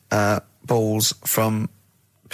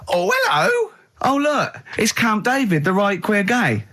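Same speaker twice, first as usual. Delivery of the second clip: very high, sing-song camp voice; much higher, camp greeting, slower at the end; camp comic character; 10 semitones higher than usual. Acted. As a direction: ham up a flamboyant sketch character. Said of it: "It always starts with a sort of doorbell".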